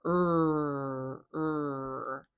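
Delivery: long, held "er" with R colouring; "er" as a sustained rhotic schwa; one-unit er sound. Shown as a demonstration. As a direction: draw "er" out as one continuous sound. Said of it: "makes it one unit of sound".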